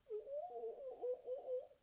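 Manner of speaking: high mock monkey sobs; sobbing monkeys. Monkeys sobbing back at the man, high mock sobs. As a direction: sob back like copying monkeys. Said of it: "sobbed back at him".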